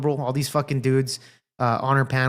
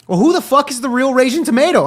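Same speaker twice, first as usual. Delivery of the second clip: higher and louder, incredulous; exasperated outburst; 9 semitones higher, 5 dB louder than usual. Real, not acted. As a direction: throw the question out in disbelief. Exasperated rhetorical question.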